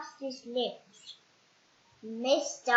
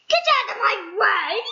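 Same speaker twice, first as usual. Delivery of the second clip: loud, high rude yell; rude scream; 8 semitones higher, 10 dB louder, faster than usual. Acted. Mister Uppity screaming at a little boy. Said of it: "screamed Mister Uppity rudely".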